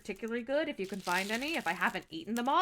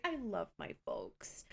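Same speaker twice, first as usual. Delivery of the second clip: whispering; 3 semitones lower, 7 dB quieter, slower than usual. Real, not acted. Hushed aside about parents.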